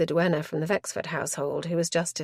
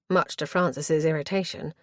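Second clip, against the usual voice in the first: ordinary delivery, nothing marked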